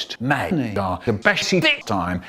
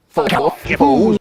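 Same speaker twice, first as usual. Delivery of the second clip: mock dismissive snap; 3 semitones higher, 4 dB louder than usual. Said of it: "we'd have said".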